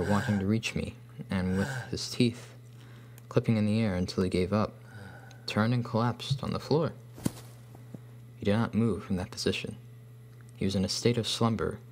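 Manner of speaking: ordinary delivery, nothing marked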